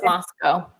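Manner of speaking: Moscow with a long "oh" ending; Idaho pronunciation. Shown as a demonstration. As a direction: say "Moscow" as "MOSS-coh". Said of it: "but Idaho".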